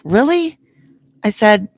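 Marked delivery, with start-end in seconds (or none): 0.0-0.6 high-pitched surprised "Really?"; retold disbelief, pitch leaping up. [name] reacts to his radio suggestion, surprised.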